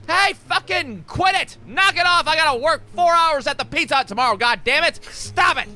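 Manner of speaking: high, strained mock yell; yelling down the vent. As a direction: yell up through the floor to make them stop. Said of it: "I yell something along the lines of".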